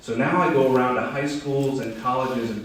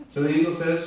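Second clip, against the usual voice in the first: usual speaking voice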